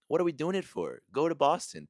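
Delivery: exasperated rant. Real, not acted. Ranting about bad Boston accents.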